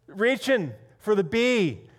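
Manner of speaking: ordinary talk, nothing marked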